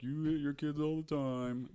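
high, put-on mocking voice; mocking accusers. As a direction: mimic overblown public accusation. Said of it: "suddenly everyone's like".

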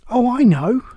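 high, loud burst of realisation; sudden exclamation. A narrator's reading. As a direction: exclaim it as it dawns on you. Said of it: "exclaimed Alice".

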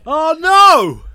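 loud, high "oh no" cry; dismayed cry. Real, not acted. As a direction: react in loud mock horror. Reacting to the finger prick.

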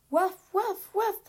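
high, loud little barks; dog barking. Acted. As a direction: bark like a small dog. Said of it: "en langage chien". (aboiements de petit chien, aigus et forts)

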